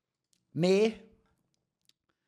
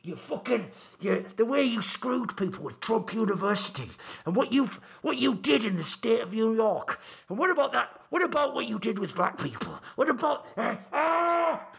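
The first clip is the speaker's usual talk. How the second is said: imitating a ranting fan, voice pitched up; mock rant into a can; 5 semitones higher than usual. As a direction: ham up a frantic rant at the TV. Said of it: "just screams into it like".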